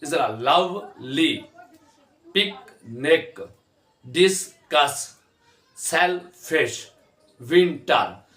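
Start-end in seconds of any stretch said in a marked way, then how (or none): 0.3-1.5 लवली split slowly into लव and ली; drawn-out two-part लवली; syllable by syllable. Shown as a demonstration. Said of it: "syllable से pronounce कराते हैं". 2.3-8.3 पिकनिक, डिस्कस, सेलफिश, विंटर each broken into two slow beats; slow teacher's split of पिकनिक, डिस्कस, सेलफिश, विंटर; syllable by syllable. Shown as a demonstration. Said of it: "syllable से pronounce कराते हैं".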